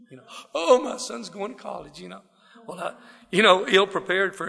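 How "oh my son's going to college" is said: proud daddy voice. Retold or imitated. Imagining his proud daddy.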